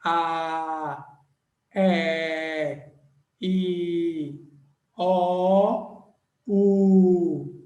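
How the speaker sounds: A, E, O, U each drawn out; held vowels. Shown as a demonstration. Teacher shows class how to do it, held vowels. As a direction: sustain A, E, O, U one by one. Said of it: "Só faz assim".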